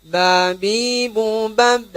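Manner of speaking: "Babi, bubab" ringan; light ba demo. Shown as a demonstration. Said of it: "Huruf ba juga begitu". ("Babi" ringan)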